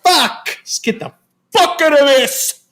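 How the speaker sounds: shrill, panicked yelp of disgust; mock panic. Intruders recoiling from naked photos, mock panic. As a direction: recoil in mock horror, voice jumping high.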